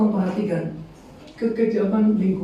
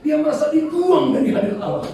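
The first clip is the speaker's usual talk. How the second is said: raised, higher-pitched cry of someone cast out; retold cry; 9 semitones higher, 4 dB louder than usual. Psalmist feeling cast away from God, half-shouted retold cry. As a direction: lift the pitch as if crying out. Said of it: "Bahkan dia berteriak".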